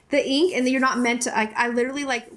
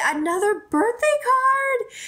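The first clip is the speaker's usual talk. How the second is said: high, drawn-out delighted surprise; 12 semitones higher than usual. Real, not acted. YouTuber finding another card from a friend, delightedly surprised. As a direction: exclaim it slowly, pitch leaping up. Finds a second birthday card.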